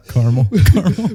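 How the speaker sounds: giggly high words; laughing. Real, not acted. Laughing at the joke.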